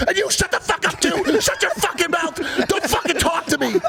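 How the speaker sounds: high-pitched retold screaming; furious lashing out. Imitating raging friend turning on the peacemaker, furious high scream. Friend snaps at teller in story.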